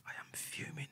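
unvoiced whisper, seething. Real, not acted. Telling the audience how angry he was, a seething whisper. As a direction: hiss it under your breath.

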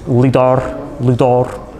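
lidar in a mock Swedish accent, "LEE-dah". Shown as a demonstration. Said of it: "saying lidar with a Swedish accent".

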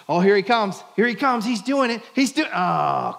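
high, loud running cheer sinking into a groan; root for him eagerly, then deflate; excited cheering, then letdown. Pretend. Imagined Jesus watching Peter walk, excited then let down.

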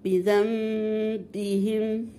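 "بذنبهم" with a held "mm", then a firm ba; iqlab, light lip contact. Shown as a demonstration. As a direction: recite "بذنبهم" very slowly, lips lightly closed on a long hummed meem for the noon. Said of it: "خفيف مع مراعاة إيه؟".

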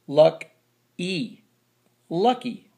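"Luck", pause, high "E", then "Lucky"; long E ending. Shown as a demonstration. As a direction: sound out "Luck E" and blend to "Lucky". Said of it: "How do you say that?".

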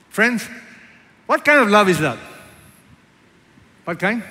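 loud, high ringing appeal; preaching at full force. Acted. Pastor challenging the congregation, loud full-force preaching. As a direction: thunder the question at the pews.

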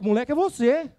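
alto, agudo e com voz mudada; revide encenado. Shown as a demonstration. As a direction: devolva na hora, com força. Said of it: "E se o cliente". (vendedor devolvendo a ofensa ao cliente, alto)

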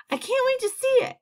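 very high, eager cry; excited shout. A narrator's reading. Creatures about to climb the ladder, excited shout. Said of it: "shouts of".

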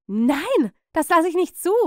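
loud, high outburst of refusal; outraged aunt losing control; flipping out. A narrator's reading. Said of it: "Meine Tante drehte völlig durch".

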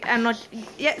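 ordinary delivery

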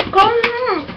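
high sing-song call to the dog. Real, not acted. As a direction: coax the dog up, pitched high. Calling the dog.

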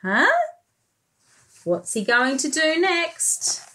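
usual speaking voice

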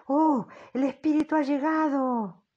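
high, loud startled cry; loud, high shout of alarm; frightened cry. Acted. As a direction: cry out as the spirit arrives. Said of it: "y grito".

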